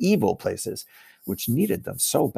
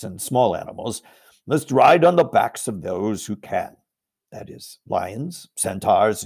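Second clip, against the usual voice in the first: Aslan commanding. Acted. Aslan orders the army to form up, slow and commanding. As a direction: command the troops with calm weight.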